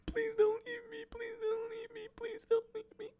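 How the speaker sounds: very high-pitched wailing plea, imitated; hysterical crying. Unknown crier heard on waking, hysterically pleading. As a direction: imitate a faint, hysterical, squeaky sob. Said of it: "hysterical crying".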